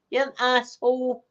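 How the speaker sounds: normal talking voice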